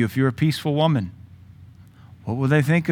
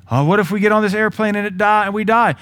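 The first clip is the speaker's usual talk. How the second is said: high, fretful worried voice; mock worried thought; 6 semitones higher than usual. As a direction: whine it like an anxious inner voice.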